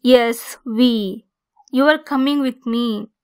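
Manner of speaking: ordinary delivery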